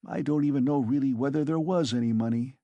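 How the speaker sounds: plain reading voice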